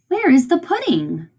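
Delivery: a mildly puzzled mum, lifted in pitch; confused mother. Acted. Teacher reads mother asking about the pudding, confused. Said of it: "The mom's confused".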